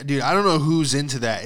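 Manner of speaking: ordinary talk, nothing marked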